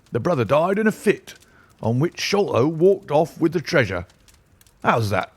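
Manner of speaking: high, blustering police inspector; bluff, self-satisfied inspector; pompous inspector. A narrator's reading. Athelney Jones speaking, pompous.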